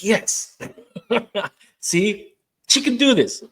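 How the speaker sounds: very high, loud cheer broken by a laugh; root for her like a proud coach; delighted cheering. Real, not acted. Therapist cheering the host's independence, high laughing cheer.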